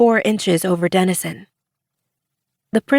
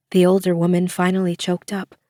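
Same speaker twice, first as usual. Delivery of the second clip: ordinary delivery, nothing marked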